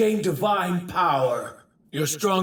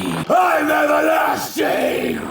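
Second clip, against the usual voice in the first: high, drawn-out boast; exultant shout; 9 semitones higher, 5 dB louder, slower than usual. Acted. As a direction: yell your immortality slowly and high. Villain god boasts in battle.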